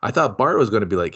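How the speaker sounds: usual speaking voice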